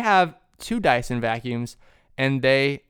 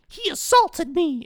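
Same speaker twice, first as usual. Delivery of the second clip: high, drawn-out accusing cry; mock outrage; 14 semitones higher, slower than usual. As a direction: play up the complaint, pitched way up and slow. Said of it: "then she was like".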